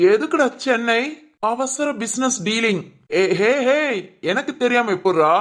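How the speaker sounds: ordinary talk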